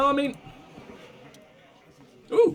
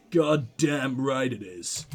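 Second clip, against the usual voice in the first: slow, low grumbling agreement; gruff; 6 semitones lower, slower than usual. Acted. Gruff officer at the table, slow low grumble. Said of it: "A gruff humph from the table".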